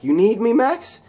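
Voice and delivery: high, loud rising question; imitated taken-aback hero; acted surprise. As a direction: ask it in disbelief. Spider-Man surprised by request.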